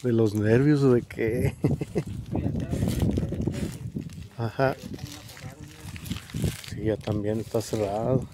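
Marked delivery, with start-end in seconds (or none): 1.6-4.3 breathy jittery laugh; nervous laugh. Real, not acted. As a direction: laugh from nerves. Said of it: "Ya no sé si me río de, de los nervios".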